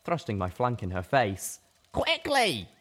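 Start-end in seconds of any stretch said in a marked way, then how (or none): 1.9-2.7 high, urgent, breathless hurry; urgent plea. Acted. Little Pip urging Velvet to hurry, urgent. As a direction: push her to hurry. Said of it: "I insisted".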